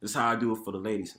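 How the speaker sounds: ordinary talk, nothing marked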